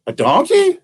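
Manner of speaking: put-on a storyteller teasing kids with a wild guess; silly high guess. Silly guess, pitch jumps.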